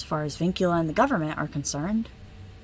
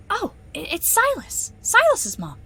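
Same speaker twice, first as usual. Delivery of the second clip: surprised; 11 semitones higher, 4 dB louder than usual. Acted. Recognizes the sender's name.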